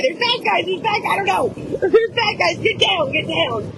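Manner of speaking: high, frantic re-enactment with some laughter; retold panic. Pulling Finn down to the floor, mock-panicked warnings. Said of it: "So I went straight panic".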